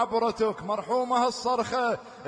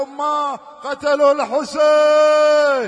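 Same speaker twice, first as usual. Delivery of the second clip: louder and higher, stretched anguished cry; anguished shout; 4 semitones higher, 12 dB louder, slower than usual. Acted. Bishr crying out to the mother that Hussein was killed. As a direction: break the news in a wail. Bishr cries the death news.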